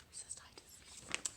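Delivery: slow, faint whisper of cystitis; slow hushed whisper; whispered word. Shown as a demonstration. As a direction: let cystitis fade to a whisper. Trailing list ending in a hush.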